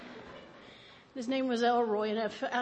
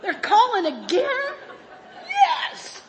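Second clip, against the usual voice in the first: much higher, louder ecstatic squeal; thrilled; 12 semitones higher, 8 dB louder, slower than usual. Pretend. Answering a ringing phone, ecstatic high squeal. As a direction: squeal like the best news ever. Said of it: "do you go".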